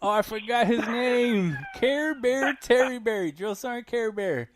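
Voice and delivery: high, loud laughing words. Real, not acted. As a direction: crack up saying the nickname. Laughing about sergeant's nickname.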